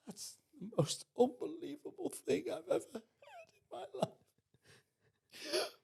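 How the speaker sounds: high, laughing through tears; tearful laughing Claire. Retold or imitated. Claire teasing Pete at dinner, laughing through tears. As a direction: voice Claire laughing and crying at once. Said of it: "Claire is crying her eyes out".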